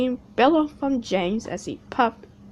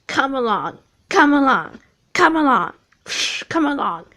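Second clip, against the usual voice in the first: puffing; 4 semitones higher, 6 dB louder than usual. Acted. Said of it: "he puffed".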